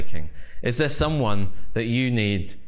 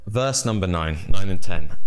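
ordinary talk

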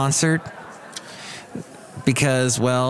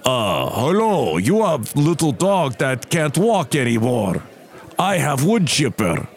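thick mock Russian accent; Eastern European accent. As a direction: put on a heavy Russian-style accent. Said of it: "thick kind of Eastern Europe accent".

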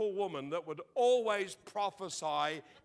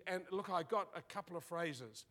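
ordinary delivery

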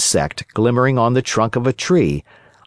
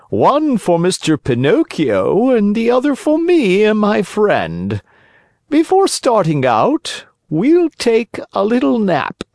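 sly fox voice; 11 semitones higher than usual. A narrator's reading. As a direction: play the crafty fox, pitched up. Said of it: "the fox said to the innkeeper".

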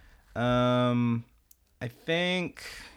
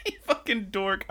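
laughing; 8 semitones higher, 5 dB quieter than usual. Real, not acted. Laughing at friend's joke.

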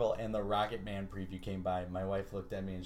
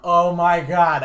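slow, loud, drawn-out laughing Oh my God; stunned laughing outburst; 7 semitones higher, 16 dB louder, much slower than usual. Retold or imitated. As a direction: blurt it out loud while cracking up in disbelief. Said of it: "I laughed out loud in the fucking theater".

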